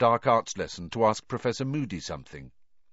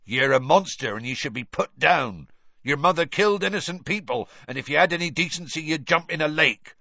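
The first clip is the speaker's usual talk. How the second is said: hate mail read out; 7 semitones higher, 5 dB louder than usual. Retold or imitated. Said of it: "those letters".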